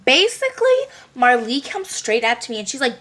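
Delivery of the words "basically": squeaky repeat. Acted. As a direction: repeat basically in a high squeak.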